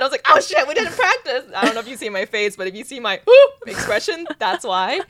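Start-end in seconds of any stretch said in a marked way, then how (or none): 0.3-1.4 high, loud mock panic; blurting out sudden alarm; panicked. Bride realizing she skipped practice, panicked blurt.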